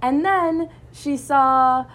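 ordinary delivery

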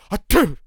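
loud hammed-up fake sneeze; a loud put-on sneeze; mock sneeze. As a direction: fake a big sneeze right at them. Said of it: "and go".

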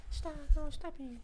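very high, soft mock protest. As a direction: feeble falsetto pop-star pleading. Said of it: "Fucking with his soft voice".

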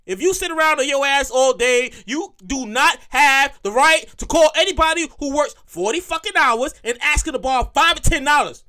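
ordinary talk

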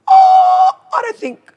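very high, drawn-out hesitant oh; mock uncertainty. Retelling her reply to a question about staff, mock uncertainty. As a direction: squeak out a stalling answer.

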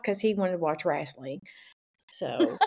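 normal talking voice

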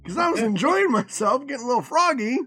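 ordinary talk, nothing marked

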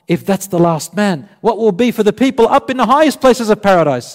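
high, loud, climbing astonishment; amazed question. Acted. Companions asking the Prophet about the highest ranks, amazed.

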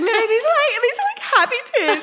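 high, loud voice bubbling with laughter; retold gushing through laughs; laughing reassurance. Mum telling her son these are happy tears, laughing reassurance.

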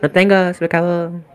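put-on high, childlike excuse in Spanish; high-pitched Spanish voice. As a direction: play a little kid making excuses, amused. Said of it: "like, it's high-pitched".

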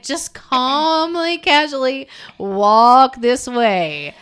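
stretched-out, carefully casual instruction; mock calm coaxing. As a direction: draw each word out very slowly, pretending all is casual. Acting out someone coaxing a friend away.

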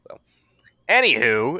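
ordinary delivery

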